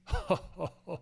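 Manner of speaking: soft chuckle of an unseen spirit; mock laughter. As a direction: laugh quietly, as if from far up a mountain. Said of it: "stopped by some laughter".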